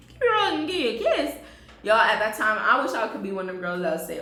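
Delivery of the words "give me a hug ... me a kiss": high laughing mock repeat. Real, not acted. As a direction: repeat his line through laughter, pitched up.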